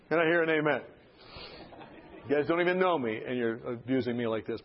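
ordinary delivery

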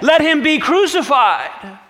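high, loud insistent call; the mob calls for his death; crowd demanding. Acted.

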